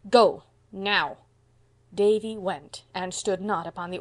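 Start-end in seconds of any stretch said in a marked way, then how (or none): 0.1-1.2 loud, separated command words; stern order. Acted. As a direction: order the child out, patience gone. Exasperated woman orders boy away.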